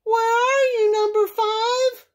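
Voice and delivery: high, loud mother duck calling; high-pitched duck voice, calling out. A narrator's reading. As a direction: call from the doorstep for a missing duckling. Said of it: "stood on her doorstep calling".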